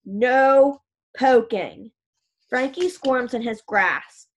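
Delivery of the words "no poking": loud, very slow stern order; angry funeral man scolding two boys in the hallway; stern demand. Acted. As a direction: lay down the law word by word. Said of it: "Mister Rijo demands".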